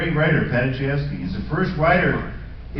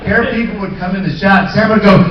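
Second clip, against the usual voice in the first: ordinary talk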